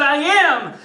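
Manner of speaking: put-on high-pitched kid's retort; protesting yell. Thirteen-year-old answering his angry dad, high yelled retort. Boy yells back at dad.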